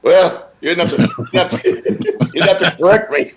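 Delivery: laughing through a mock offended whine; half-laughing, play the wounded host; laughing while mocking the offended interviewer; huffy mock reply.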